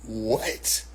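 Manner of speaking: very low, soft gasped question; hushed mock shock; stunned disbelief. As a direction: barely voice it, dumbfounded. Acting stunned response.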